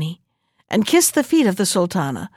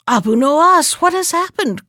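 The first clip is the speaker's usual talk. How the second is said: high, loud startled cry; sudden worried outcry; alarmed cry; 8 semitones higher, 4 dB louder than usual. Acted. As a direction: call out in shock at the tearful jester. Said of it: "cried the Sultan".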